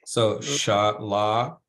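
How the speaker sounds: "Shalakaya" slowly, starting with a clear sh; sh sound. Shown as a demonstration. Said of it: "we pronounce that S as sh".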